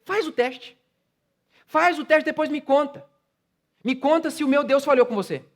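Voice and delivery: high, shouted exhortation; preaching at full force. Acted. Pastor challenging his congregation to persevere, preaching at full force. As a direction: pastor daring the church to keep praying.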